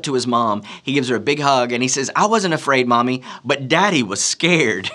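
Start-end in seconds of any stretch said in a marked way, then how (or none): 2.1-4.9 higher, louder imitated little boy; gleeful mock-child tattling on Dad; boasting child. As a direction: tell Mommy proudly in a kid voice. Son proudly tells his mother.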